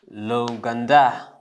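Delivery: L'OUGANDA slow, run together from the l; French country pronunciation. Shown as a demonstration. Teacher putting Uganda into French, slow demo. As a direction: say l'Ouganda slowly as loo-gan-da. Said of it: "En français".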